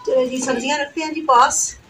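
usual speaking voice

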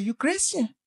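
put-on high-pitched Spanish-accented question; imitated Spanish accent. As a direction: mimic the driver asking in accented English. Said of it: "forgive me of this accent".

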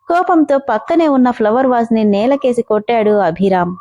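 plain reading voice

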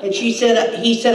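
usual speaking voice

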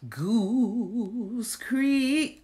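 usual speaking voice

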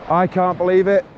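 ordinary talk, nothing marked

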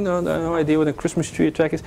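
ordinary delivery

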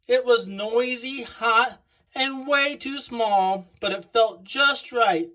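ordinary delivery, nothing marked

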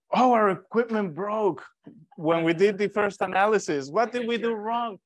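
baffled doctors. Retold or imitated. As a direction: play the doctors scratching their heads. Doctors baffled by broken equipment.

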